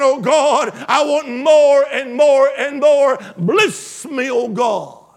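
preacher shouting the people's plea for blessing; shouted plea. Acted. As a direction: cry it out to God at full voice. Congregation's cry acted out, shouted.

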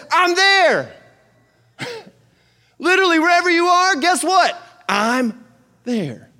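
high, loud fervent prayer; fervent preaching prayer; prayer demo. Acted. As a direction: preach the prayer with full force.

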